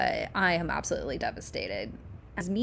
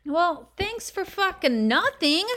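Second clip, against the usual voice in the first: high, slow, bitter sarcasm; sarcastic; 12 semitones higher, 4 dB louder, slower than usual. Real, not acted. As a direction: drag out the fake thanks. After the useless phone menu.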